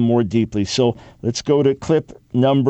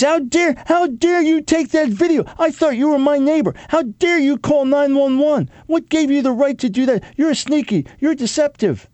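much higher, squeaky whining outrage; mock indignant attacker; 12 semitones higher than usual. Host mocking Planned Parenthood as a caught attacker, whiny high indignation. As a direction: whine it like a caught hypocrite. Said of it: "then he's".

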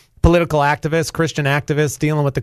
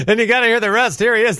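repeating the line through a laugh, higher; laughing echo; 6 semitones higher than usual. Real, not acted. Host laughs as he echoes Michael's introduction. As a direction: chuckle while parroting the intro.

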